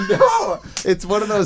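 high laughing protest. Real, not acted. Refusing friend's joke while laughing high. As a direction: giggle out the denial.

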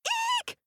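very high mouse squeak; papa mouse's terrified eek. Acted. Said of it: "Papa Mouse said".

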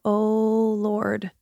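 ordinary delivery

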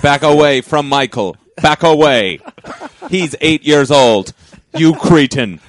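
loud, commanding warning; loud stern scolding; stern alarm voice. Pretend.

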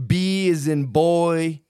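put-on B as in boy, A as in eight ball, muy lento; imitating slow spelling. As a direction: imita a Frank deletreando despacio. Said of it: "and he's like".

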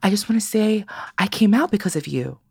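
put-on high earnest kid's confession; high sincere kid voice; young boy's voice. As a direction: voice a shy boy telling his idol. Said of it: "He goes".

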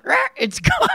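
parrot voice. Shown as a demonstration. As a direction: shriek it like a parrot. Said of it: "I like the parrot voice one".

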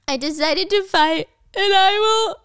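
mock panic, pushing the words out between gasps; panicked gasping retake of the vow; hyperventilating. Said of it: "she's, like, hyperventilating".

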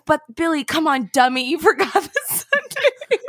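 high, teasing, talking down to him; mock him like a bratty kid; condescending bratty tone. Wife teasing husband who forgot the sundaes, condescending and bratty. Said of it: "In, like, my condescending, bratty tone".

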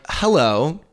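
high, pointed hello lesson; mock-scold the rude fan; sarcastic. To a fan demanding a photo, a high sarcastic greeting. Mocking fans skipping greetings.

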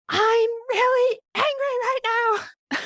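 high, drawn-out yell of anger. Retold or imitated. Mother to her daughter, yelling that she is angry. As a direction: shout it out slowly, letting the anger show. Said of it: "but I yelled".

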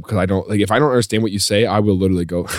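ordinary delivery

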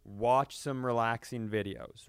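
normal talking voice

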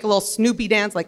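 ordinary talk, nothing marked

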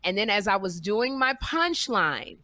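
ordinary delivery

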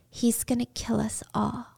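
breathless, secret warning; hushed frightened warning to the neighbour; scared whisper. Retold or imitated. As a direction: whisper it so the captor can't hear. Jill's terrified hint to neighbour.

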